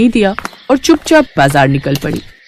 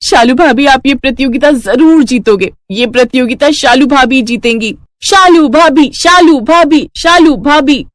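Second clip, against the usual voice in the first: rally behind your sister-in-law; excited cheering; 5 semitones higher than usual. Acted. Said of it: "उसे बहुत प्रोत्साहित कर रहे थे".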